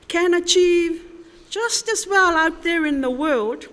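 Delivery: ordinary talk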